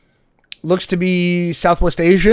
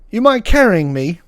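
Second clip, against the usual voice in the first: put-on British accent; 4 semitones higher than usual. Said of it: "The British guy goes".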